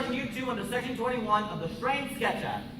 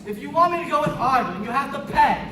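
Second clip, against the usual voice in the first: loud insistence; 4 semitones higher, 8 dB louder than usual. Acted. Clerk insists on payment.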